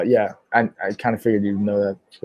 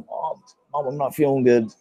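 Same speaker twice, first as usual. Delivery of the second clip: soft, slightly raised pretend-sick whine; feigning sick; 3 semitones higher, 3 dB quieter than usual. Kid hiding a puppy calls mom, softly feigning sickness. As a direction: act a little poorly to fool mom. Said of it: "so I had her there and I go".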